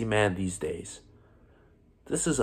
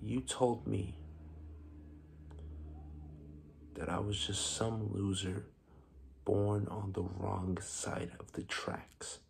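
subdued and wounded, letting each phrase sit; quiet bitterness; 3 semitones lower, 8 dB quieter than usual. Acted. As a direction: speak softly, with long pauses, nursing the grievance. Bitter monologue, recalling insults.

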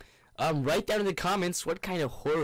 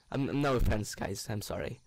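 normal talking voice

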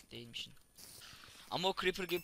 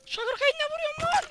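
very high, loud frantic shout; frantic yell; 10 dB louder than usual. Real, not acted. Shouting that he only hits with sugar cane. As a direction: yell it in disbelief mid-fight. Yelling in game panic.

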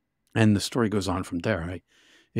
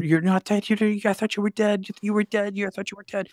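much higher, frantic voice of a shocked friend; imitating a frantic climber; 12 semitones higher, faster than usual. Tim finds his partner alive and screams it, frantic and high. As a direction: play the partner who can't believe you're alive, pitched way up. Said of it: "he's yelling at me and screaming at me".

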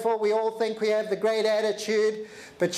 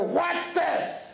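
high scornful "What's this?"; disgusted; 8 semitones higher than usual. Retold or imitated. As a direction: sneer at the sandwich. Rude passenger voiced.

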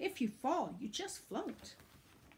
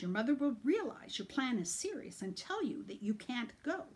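ordinary delivery, nothing marked

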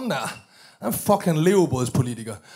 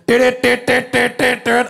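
imitated window noise; 6 semitones higher, 7 dB louder than usual. Mimicking window button.

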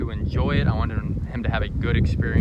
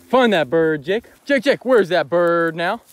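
loud, slow urging call, rising; loud dog command; 5 semitones higher, 9 dB louder, slower than usual. Real, not acted. Hunter urging his dog to find the bird, loud.

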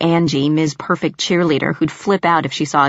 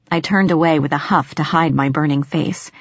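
plain reading voice

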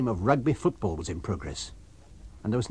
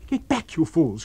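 angry cry; 6 semitones higher, 5 dB louder than usual. A narrator's reading. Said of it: "cried the muffled voice of Kemp".

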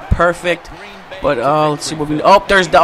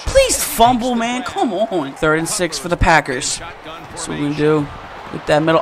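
ordinary talk, nothing marked

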